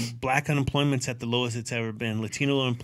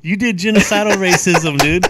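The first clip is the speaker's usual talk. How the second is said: laughing tease; 5 semitones higher, 10 dB louder than usual. Real, not acted. Teasing friend, repeated.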